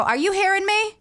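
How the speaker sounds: put-on calling out in a Maine accent. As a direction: call across the house in a Maine accent. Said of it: "an accent comes out".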